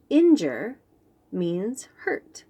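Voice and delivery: usual speaking voice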